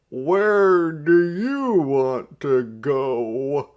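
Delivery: loud, slow, high shout over the snow; hollering driver. A narrator's reading. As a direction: yell it across to the passengers. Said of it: "the driver hollered".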